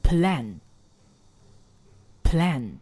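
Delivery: Plan with the mouth wide open, a broad æ. Shown as a demonstration. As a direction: say Plan opening the jaw wide. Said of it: "你的plan似乎没有把嘴张大哦". (张大嘴读Plan)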